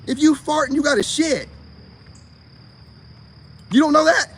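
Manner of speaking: normal talking voice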